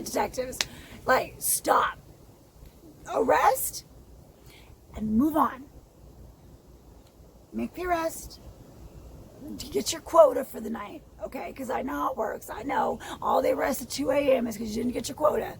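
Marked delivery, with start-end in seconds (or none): 1.1-8.4 angry. Real, not acted. Angry rant at police. 12.5-15.5 angry shout. Real, not acted.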